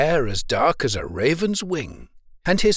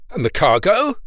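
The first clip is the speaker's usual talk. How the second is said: higher, louder eager question; 5 semitones higher, 5 dB louder than usual. A narrator's reading. Owner asks the young sailor about his cargo, eagerly. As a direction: ask keenly, with voice lifted. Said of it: "inquired the owner eagerly".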